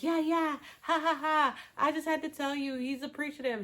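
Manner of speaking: high-pitched imitation with fake ha-ha; mock breezy chatter. Chelsea tells A D he's appreciative, mock breezy chatter. Retold girlfriend's forced laughing chatter.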